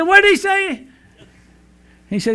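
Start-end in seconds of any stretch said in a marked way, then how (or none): 0.0-0.9 high, loud blurt from a hard-of-hearing wife. Acted. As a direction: blurt it out much higher and louder. Wife voiced in joke.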